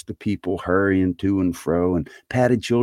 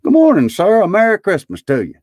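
high, loud, hearty holiday greeting; jolly fellows hailing a gentleman; good-humored greeting; 14 semitones higher, 7 dB louder than usual. A narrator's reading. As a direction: call it out cheerily, pitched way up. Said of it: "three or four good-humored fellows said".

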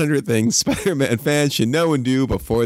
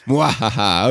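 sneering panto villain; 3 semitones lower than usual. Pretend. Mock villain laugh after joke.

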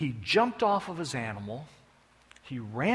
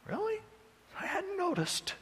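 much higher, mocking and laughing; high sarcastic quip; 7 dB quieter than usual. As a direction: toss it back with a sneer. Sarcastic retort in acted dialogue.

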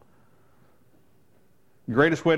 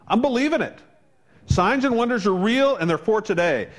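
excited; 8 semitones higher than usual. Real, not acted. Preacher recalling his excitement over signs and wonders, high and eager. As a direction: lift it with rising excitement.

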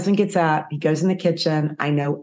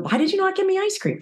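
much higher, fast petulant complaint; whiny spouse; faster than usual. Retold or imitated. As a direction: whine it like a sulking child.

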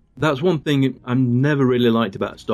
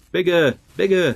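excited director; 7 semitones higher than usual. Retold or imitated. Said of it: "I can imagine Peter DeLuise running around".

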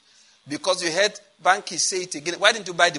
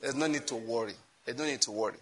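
soft repeat; 4 semitones lower, 7 dB quieter than usual. Shown as a demonstration. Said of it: "What did I say?".

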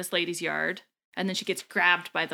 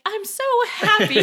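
high loud laughing granny; old lady voice; 13 semitones higher, 7 dB louder than usual. Retold or imitated. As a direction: gush like a giddy old woman. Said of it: "she's the one who's like".

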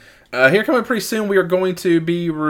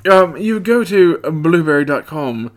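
put-on British accent; 3 semitones higher than usual. Host apologising to UK listeners, exaggerated British accent. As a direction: overdo the British vowels. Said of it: "sorry for being incredibly offensive".

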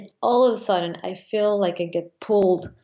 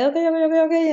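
much higher, string of quick reassurances to oneself; urgent self-talk; 9 semitones higher, 4 dB louder, much faster than usual. Retold or imitated. Pilot talking herself through a strong climb, high and urgent. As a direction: re-enact anxious self-talk, pitched right up. Acting out nerves during fast climb.

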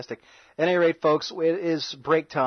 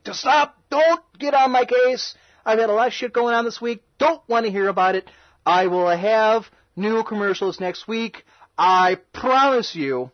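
exasperated outburst; 6 semitones higher than usual. Acted. Host snaps at nagging listeners.

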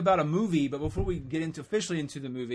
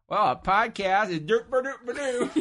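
goofy voice; 6 semitones higher than usual. Pretend. Goofy joke answer.

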